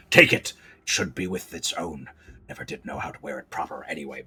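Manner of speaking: brief firm offer, then low and hushed; old pirate hare. Acted. Old hare handing over the brooch, low pensive pirate voice. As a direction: pensive pirate, trailing down very soft. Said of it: "After a longer pensive pause".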